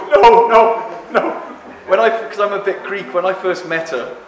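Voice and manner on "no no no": wife protesting. Retold or imitated. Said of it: "she'd be going".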